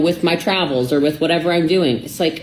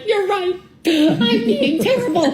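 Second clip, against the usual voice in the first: much higher, slow, laughing admission; laughing mock apology; slower than usual. Conceding to her boyfriend while laughing, high pitched. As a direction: giggle through a fake-sorry confession.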